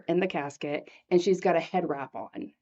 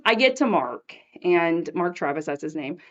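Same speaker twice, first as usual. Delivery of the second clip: ordinary talk, nothing marked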